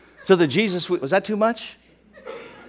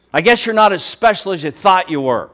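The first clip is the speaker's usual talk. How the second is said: taunting; 6 semitones higher, 7 dB louder than usual. Acted. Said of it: "That's what they're saying to him".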